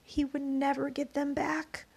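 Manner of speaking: usual speaking voice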